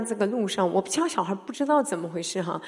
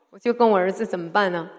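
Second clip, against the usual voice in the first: ordinary talk, nothing marked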